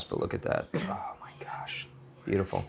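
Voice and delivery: breathless whisper of amazement; awed whisper. Real, not acted. Cook marveling at crispy fries, whispering. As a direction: whisper it, wowed by the food.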